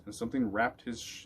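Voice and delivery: usual speaking voice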